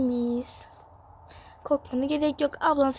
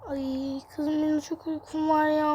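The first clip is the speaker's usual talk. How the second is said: normal talking voice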